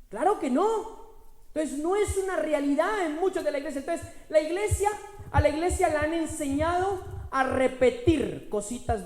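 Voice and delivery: loud, high, building preacher's cry; preaching at full force. Acted. Pastor proclaiming to the congregation, loud and rising. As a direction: proclaim the power within, rising.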